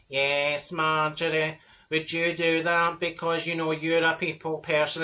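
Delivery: slow, level drone, slightly low; droopy, monotone drawl; droopy Dorothy. Acted. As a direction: drone it out wearily, word by word. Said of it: "Droopy Drawers".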